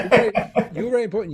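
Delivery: high, loud words through laughter; amused, laughing praise. Real, not acted. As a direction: say it while cracking up. Comedian reacts to the infectious laugh.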